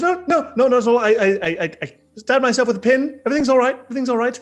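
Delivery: high, loud, stammering panic; flustered cover-up. Acted. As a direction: hurriedly reassure the servant in a squeaky fluster. Covering up before a servant.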